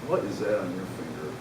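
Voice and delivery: a hint of grandmotherly disappointment; disappointed grandma. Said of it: "like a grandma would look at you".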